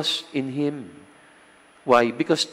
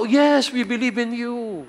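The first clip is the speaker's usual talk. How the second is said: high, bright chorus of the crowd; lightly voiced believing crowd; eager crowd; 8 semitones higher than usual. Crowd answers again.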